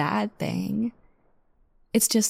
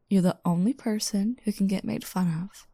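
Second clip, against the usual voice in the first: ordinary talk, nothing marked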